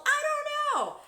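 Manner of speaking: very high, loud I-don't-know yell; exasperated shout. Real, not acted. Streamer gives up guessing, shrieking to viewers. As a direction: throw up your hands and shriek it.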